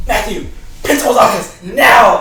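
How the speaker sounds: angry teacher command. Retold or imitated. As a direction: shout it like a teacher sending a student out. Teacher ordering student to principal's office.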